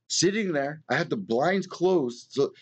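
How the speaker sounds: ordinary delivery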